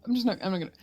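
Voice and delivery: usual speaking voice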